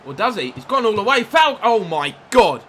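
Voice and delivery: building to a very loud, high yell; excited shout. Real, not acted. Commentating his own FIFA match as a chance goes begging, rising shout.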